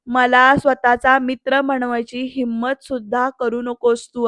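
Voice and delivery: ordinary delivery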